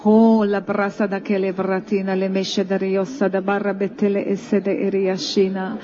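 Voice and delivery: slow, sighing tongues prayer; speaking in tongues. Shown as a demonstration. Pastor demonstrates tongues for the congregation to join. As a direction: let the syllables flow from a sigh. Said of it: "ahogy én most elkezdem".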